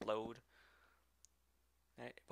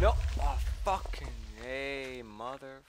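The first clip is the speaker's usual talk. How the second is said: slow, loud frustrated swearing; angry cursing; 2 semitones higher, 10 dB louder than usual. Real, not acted. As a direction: curse it out, drawn out. Creeper exploded, cursing.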